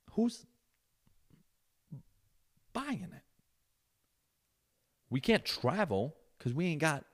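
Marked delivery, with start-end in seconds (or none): 0.1-3.2 much higher, softer mock voice; mock innocent question. Husband mimicking wife asking who pays, high mock voice. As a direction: play dumb in a high little voice.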